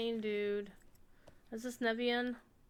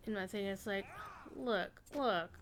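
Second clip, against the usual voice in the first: ordinary talk, nothing marked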